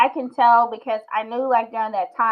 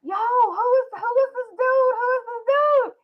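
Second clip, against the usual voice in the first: excited disbelief; 12 semitones higher than usual. Retold or imitated. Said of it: "I was like".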